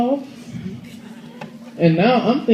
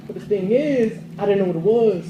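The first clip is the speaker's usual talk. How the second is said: ordinary talk, nothing marked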